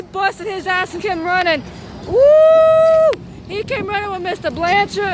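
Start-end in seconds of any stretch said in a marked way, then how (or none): 2.1-3.1 high, loud whooping hoot; long, high excited woo. Retold or imitated. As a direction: whoop like a cheering crowd. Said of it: "went, woo!".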